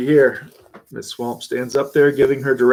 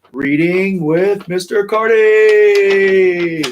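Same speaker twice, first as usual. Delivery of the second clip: announcer; 6 semitones higher, 7 dB louder, much slower than usual. Real, not acted. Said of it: "show title announced".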